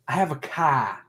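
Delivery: Rhode Island "car" with the R dropped; Rhode Island accent. Shown as a demonstration. Native showing friends his old Rhode Island accent. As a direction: round the vowel and lose the R in "car", Rhode Island style. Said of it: "like a Rhode Island accent".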